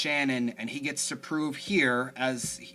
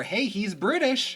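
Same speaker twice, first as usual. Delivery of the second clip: mock announcement; 10 semitones higher, 5 dB louder, slower than usual. Mocking the writers' reminder.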